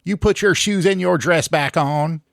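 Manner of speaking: loud scolding hillbilly mother; high, loud backwoods mom scolding; hillbilly accent. Acted.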